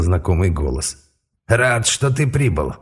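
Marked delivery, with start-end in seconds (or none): 1.5-2.7 slow croaky elder's greeting; croaking old man. A narrator's reading. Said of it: "прокряхтел старик".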